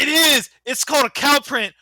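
loud, high insistence; excited. Real, not acted. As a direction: insist it's great, loudly. Defending the cow print loudly.

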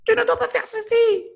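squeaky high inner voice; high-pitched voice. Shown as a demonstration. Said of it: "mais de façon aiguë".

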